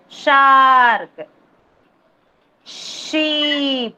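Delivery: teacher drawing out each of "Shark", "Sheep", "Shell" with a falling tune; phonics word demo. Shown as a demonstration. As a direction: stretch "Shark", "Sheep" and "Shell" long, with a long opening sh. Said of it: "Here you can hear the sh sound".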